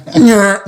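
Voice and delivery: high squeaky pained yelp, half laughing; pained whimper. Retold or imitated. Imitating his partner's yelp after the needle hit bone. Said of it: "he made this noise that came out of his soul".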